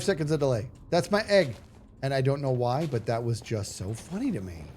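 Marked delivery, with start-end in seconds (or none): 0.9-1.5 higher, louder indignant "That's my egg"; Big B's delayed line. Retold or imitated. Big B protesting after a delay over his spawn egg, mock indignant. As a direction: claim the egg late, with mock offence. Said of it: "And Big B goes".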